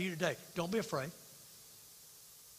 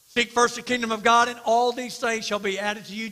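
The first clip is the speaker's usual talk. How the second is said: proclaimed loudly; 4 semitones higher, 6 dB louder than usual. Acted. Same verse repeated, proclaimed.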